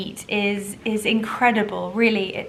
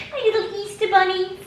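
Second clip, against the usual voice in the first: much higher crooning; 11 semitones higher, 4 dB louder than usual. Real, not acted. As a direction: croon to the bunnies. Cooing at dogs.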